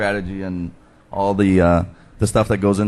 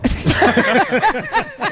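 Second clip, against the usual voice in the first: sudden high burst of laughter. Real, not acted. Guest laughs at crude joke.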